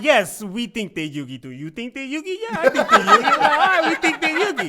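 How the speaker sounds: high, loud playful mock banter; hammed-up agreeable fan reply; mock agreeing. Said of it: "we're always like".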